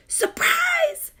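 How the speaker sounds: high excited exclamation; surprise. A narrator's reading. Child's note shouting surprise. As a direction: burst out the word.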